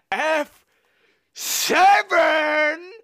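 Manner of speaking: "seven" shouted high and loud; F seven, bellowed from the movie; movie quote shout. Retold or imitated. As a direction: yell "seven!" like the film. Quoting Dirty Work scene.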